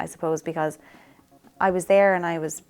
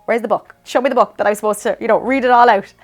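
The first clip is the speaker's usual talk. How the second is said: retold flustered questions, building louder; raised, louder, half-laughing panic at not knowing; comic panic; 5 semitones higher, 9 dB louder than usual. Retold panic about praying with him.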